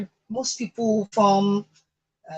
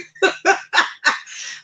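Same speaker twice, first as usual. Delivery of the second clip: breathy high laugh; 12 semitones higher, 7 dB louder than usual. Real, not acted. Laughing after forgiving.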